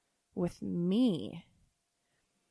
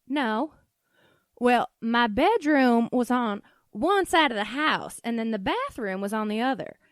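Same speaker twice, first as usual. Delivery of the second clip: put-on Southern accent; 2 semitones higher, 6 dB louder than usual. Mom explains the bedroom and bathroom, defensive Southern accent. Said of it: "this weird accent that I'm choosing".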